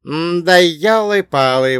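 high, loud, drawn-out exclaiming; exasperated exclamation. Acted. Dwarf reacting to a clumsy threat, exasperated exclamation. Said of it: "воскликнул карлик".

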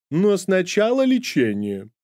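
put-on high, slow theatrical old nanny; high, slow proud invalid nanny; dramatic sick nanny. A narrator's reading. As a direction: announce illness like a triumph. Nanny insists.

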